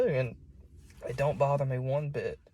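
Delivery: normal talking voice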